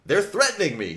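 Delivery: high-pitched alarmed plea; crying out. A narrator's reading. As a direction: cry out for help, pitched well up. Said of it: "David cried".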